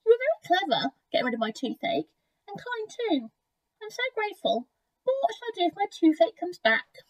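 high-pitched, thankful crocodile; high, humble story-book crocodile; grateful crocodile. A narrator's reading. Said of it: "he said".

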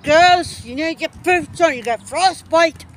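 imitating dad's loud warning. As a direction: call it out like a dad warning his girls. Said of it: "Do you not remember me dad said".

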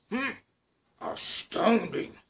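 slow, awed monster hum; a big beast struck with wonder; Gruffalo voice. Acted. Gruffalo marvels at the mouse, slow awed hum.

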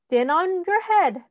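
usual speaking voice

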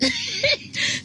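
very high short giggle. Real, not acted. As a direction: squeal out a quick laugh. Laughing at own joke.

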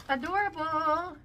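sing-song. Real, not acted.

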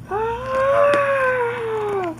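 high cry of a dragon hit; mock scream. Dragon hit by the blast, high mock scream. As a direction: yelp as if struck.

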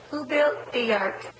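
slow, drawn-out question. Shown as a demonstration. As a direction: ask it slowly to the baby. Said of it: "Noah, Noah".

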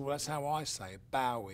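lightly voiced Gervais defending his pronunciation; Ricky Gervais impression. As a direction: mimic a Brit insisting on his way. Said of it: "he said".

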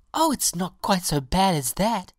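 high, bright-pitched reassurance; reassuring violet. A narrator's reading. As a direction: cheer up a complainer in a high, firm voice.

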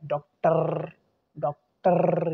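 "डॉक्टर" with a loud, long trilled final R; generator R. Shown as a demonstration. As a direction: rev the R at the end of "डॉक्टर". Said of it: "ये generator क्या है".

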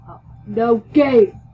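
loud bursts of yelling; yelled. A narrator's reading. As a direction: yell it in hard, uneven bursts. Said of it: "he yelled".

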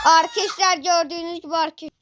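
hammed-up crying rush of words; mock wail. Streamer mock-wailing his catchphrase to viewers, crying. Catchphrase wailed mid-fight.

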